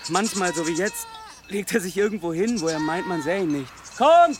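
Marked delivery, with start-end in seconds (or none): 4.0-4.4 lauter, hoher Ruf. Acted. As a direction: ruf kräftig über die Weide. Ziegen rufen. (lauter Ruf an die Ziegen)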